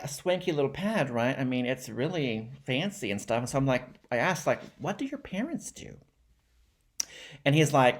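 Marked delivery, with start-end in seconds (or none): 4.8-6.0 much higher and softer acted query; high, soft question. Kid asking new friend about his family, high and soft. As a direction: ask lightly in a high, small voice.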